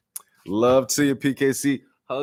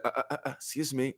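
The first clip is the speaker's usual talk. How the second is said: much softer, fast; mock polite interruption; 12 dB quieter, much faster than usual. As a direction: cut in quietly. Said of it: "he's like".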